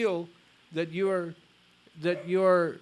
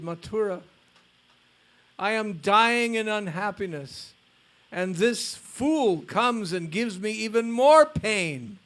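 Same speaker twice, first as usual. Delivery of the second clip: pained, louder lament with rising pitch; 4 semitones higher, 6 dB louder than usual. Retold or imitated. Madhavendra Puri lamenting to Krishna after the disciple left, pained lament. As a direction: pour out grief and indignation at once. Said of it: "he began to lament".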